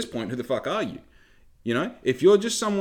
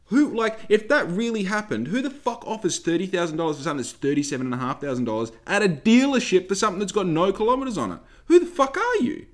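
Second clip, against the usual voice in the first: incredulous outburst; 6 semitones higher than usual. Real, not acted. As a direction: climb up to a shouted question. Peak of rant.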